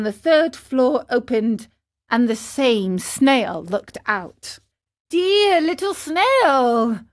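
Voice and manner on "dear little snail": high, drawn-out pleading call; crying out. Acted. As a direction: plead slowly up from the street. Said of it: "cried Pinocchio from the street".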